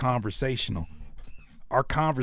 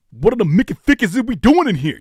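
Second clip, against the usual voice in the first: loud, higher, brash dubbed-movie voice; movie voiceover impression; 7 semitones higher, 7 dB louder, faster than usual. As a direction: belt it like a TV-edit dub. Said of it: "that kinda energy".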